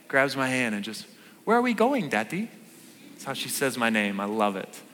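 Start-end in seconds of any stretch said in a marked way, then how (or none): 1.4-2.5 lightly voiced toddler question, much higher; little daughter voice. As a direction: ask like a trusting two-year-old. Voicing his little daughter.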